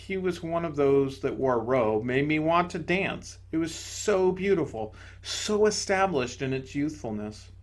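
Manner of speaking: ordinary delivery, nothing marked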